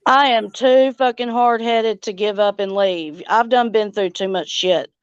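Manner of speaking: ordinary talk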